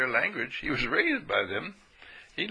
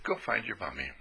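gentle shepherd; 5 semitones lower, 9 dB quieter than usual. Retold or imitated. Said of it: "And he said".

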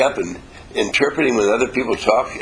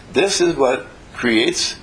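usual speaking voice

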